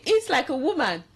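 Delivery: a lightly voiced nagging mother; quoting mom. Friend retelling her mom's table rule, mock stern. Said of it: "And they say".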